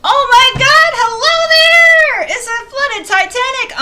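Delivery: very high, loud shrieking greeting; excited squeal. Real, not acted. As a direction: scream with joy at a new arrival. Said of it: "I might be a little excited".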